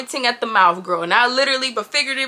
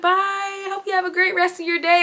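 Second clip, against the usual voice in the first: sweet waitress farewell; 6 semitones higher, 3 dB quieter than usual. Retold or imitated. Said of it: "She's like".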